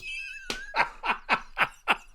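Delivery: squealing laugh; high burst of laughter. Real, not acted. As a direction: break into a high cackle. Laughs at own impression.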